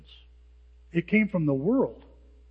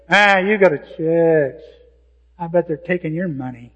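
cynical scoff; 8 dB louder than usual. Retold or imitated. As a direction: drag it out like a cynic.

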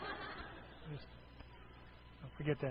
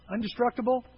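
ordinary delivery

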